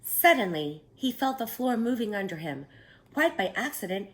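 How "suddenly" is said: ordinary delivery, nothing marked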